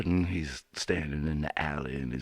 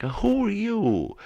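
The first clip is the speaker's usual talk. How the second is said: high startled who are you; surprised question; 6 dB louder than usual. Acted. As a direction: ask it high and puzzled. Rabbit asks strange boys.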